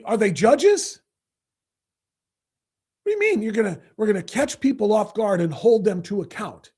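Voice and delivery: ordinary talk, nothing marked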